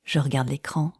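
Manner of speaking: usual speaking voice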